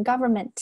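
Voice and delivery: "government" without the final al syllable; "government" stopping at ment, ending left off; dropped ending demo. Shown as a demonstration. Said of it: "不要漏掉了".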